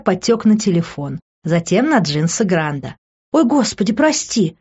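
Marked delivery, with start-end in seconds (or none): 3.3-4.6 higher-pitched flustered oops; startled apology. A narrator's reading. Woman spills juice on his jeans, startled apology. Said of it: "встрепенулась я".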